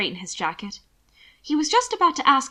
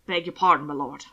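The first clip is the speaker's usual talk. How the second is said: low apology, dropping at the end; self-correcting apology; 6 semitones lower than usual. A narrator's reading. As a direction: catch yourself and apologise deferentially. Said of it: "remembering himself".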